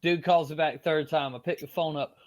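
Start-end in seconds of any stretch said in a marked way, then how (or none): none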